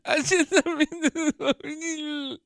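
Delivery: high, tearful wailing imitation of wife; imitating crying wife. Husband imitating his tearful wife. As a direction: mimic her sobbing, voice cracking up high.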